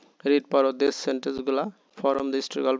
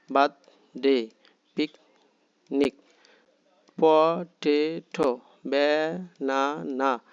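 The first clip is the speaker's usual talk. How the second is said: each word from Apple to computer slowly split into syllables; measured beats, a gap after each syllable, like picnic; clapped-out syllables; much slower than usual. Shown as a demonstration. Teacher models words for clapping along, syllable by syllable. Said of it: "the stress syllable".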